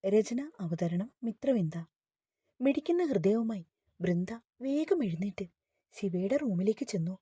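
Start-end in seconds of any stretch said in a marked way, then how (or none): none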